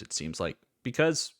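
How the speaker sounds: ordinary talk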